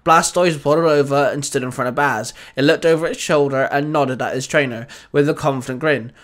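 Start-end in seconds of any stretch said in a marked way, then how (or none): none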